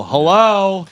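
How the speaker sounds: exasperated attention-getting shout; calling for attention. Retold or imitated. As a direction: call out to someone who isn't listening. Calling to inattentive wife, retold.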